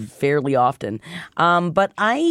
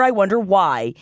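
ordinary talk, nothing marked

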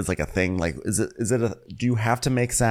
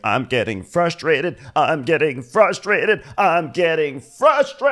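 slow, drawn-out mock meltdown, building higher and louder; Candy; 9 semitones higher, 4 dB louder, slower than usual. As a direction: stretch each repeat into a hammed-up wail. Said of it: "Candy's been pushed to her limits".

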